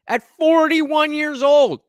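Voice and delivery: loud, high, drawn-out exasperation; exasperated outburst. Real, not acted. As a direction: raise the voice and stretch it in disbelief. Scornful outburst about interviewee's age.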